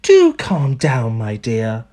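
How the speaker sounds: slow, falling, calming words; soothing wife. A narrator's reading. Wife calming husband at breakfast, soothing. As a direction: ease him down gently.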